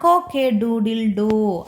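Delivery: a lightly voiced rooster crowing, slow. Teacher voicing the rooster, slow stretched crow.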